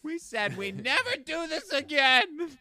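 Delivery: mock exasperated family member. Acting family member at table.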